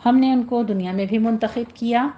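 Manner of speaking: ordinary talk, nothing marked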